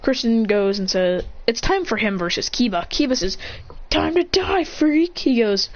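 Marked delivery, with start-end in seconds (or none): 3.9-5.2 higher-pitched fight threat; acted taunt. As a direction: taunt the opponent, pitch raised. Kiba's threat before fight.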